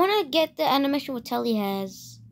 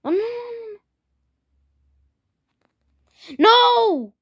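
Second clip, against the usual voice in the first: panicked yelling; 9 semitones higher, 7 dB louder than usual. Real, not acted. Losing the fight, yelling.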